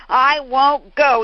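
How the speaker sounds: loud, slow, dug-in refusal; a sulky boy shouting he won't budge; stubborn refusal. Retold or imitated. Sickly Jack refusing the neighbor lady, loud and stubborn. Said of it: "He said".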